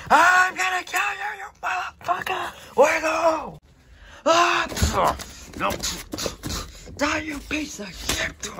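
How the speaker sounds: high, loud enraged scream; furious yell. Acted. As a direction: scream it with murderous rage. Enraged fight climax.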